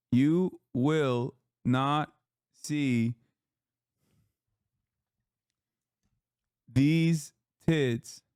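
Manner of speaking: slow, mock-solemn, words drawn out; mock solemn farewell. Streamer mock-solemnly vowing to his chat to stop, slow and drawn out. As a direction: proclaim it like a grave final goodbye. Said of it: "The bouncing act is done".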